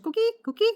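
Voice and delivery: very high frog chirps; coqui frog call. Shown as a demonstration. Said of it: "imagine a super duper high pitch".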